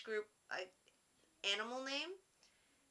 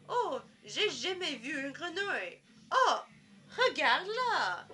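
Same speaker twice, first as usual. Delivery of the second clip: high, loud, swooping French exclamations; hammed-up amazement, pitched high; excited skit character; 7 semitones higher, 8 dB louder, slower than usual. Classmate in a school skit spotting a frog, excited and hammed-up. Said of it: "the one guy comes in and he says".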